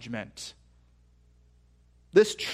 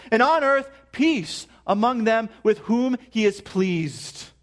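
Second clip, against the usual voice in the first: fervent scripture reading; scripture read with fervor; 5 semitones higher, slower than usual. Acted. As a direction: read it as heaven breaking open. Scripture reading builds to glory.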